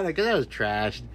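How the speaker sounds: ordinary talk, nothing marked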